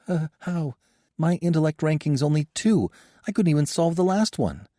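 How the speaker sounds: high, anxious frightened voice; every word dripping with fear; fearful. A narrator's reading. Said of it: "dripping with fear".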